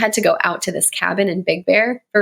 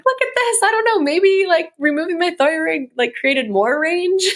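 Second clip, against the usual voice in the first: very high playful squeak, easing down; showing off high range; 10 semitones higher than usual. Retold or imitated. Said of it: "Look how high my voice".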